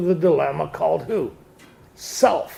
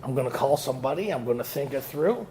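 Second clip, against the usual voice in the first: mumbled rote recital; 3 semitones lower, 8 dB quieter than usual. Retold or imitated. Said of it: "I got this formula".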